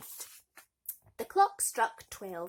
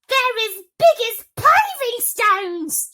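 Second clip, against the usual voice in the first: very high, loud, slow mocking shriek; 19 dB louder, slower than usual. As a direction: screech it at your sister. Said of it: "shrieked Gertrude".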